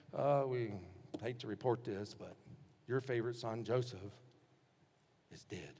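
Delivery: low, hushed, halting report; reluctant bad news. Brothers telling their dad of a death, reluctant and subdued.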